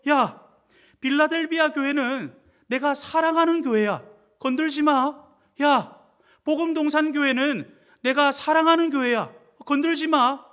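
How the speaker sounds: loud preacher voicing a divine defender; forceful protective warning. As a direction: proclaim it hard, warning off attackers. Jesus publicly defends his beloved church.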